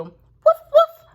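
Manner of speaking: high, loud yappy woofs; squeaky puppy woof-woof; puppy bark. Acted. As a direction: bark like a happy little puppy. Puppy barks in the story.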